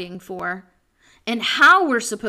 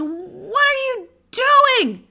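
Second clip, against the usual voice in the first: high, loud, alarmed scolding; acted shriek of outraged disbelief; yelling wife; 8 semitones higher, 6 dB louder than usual. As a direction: yell at him like a panicked wife. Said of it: "his wife yells".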